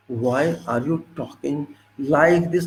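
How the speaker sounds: usual speaking voice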